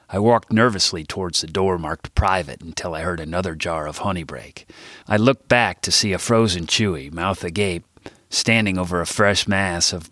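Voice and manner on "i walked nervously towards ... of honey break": ordinary delivery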